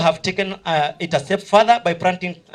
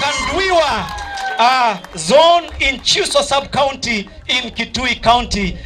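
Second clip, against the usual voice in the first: announcer shout; 6 semitones higher than usual. Real, not acted. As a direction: call the school name out to the crowd. MC announcing school winners.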